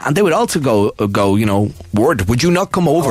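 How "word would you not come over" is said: put-on Indian accent. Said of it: "you can't do the accent for this".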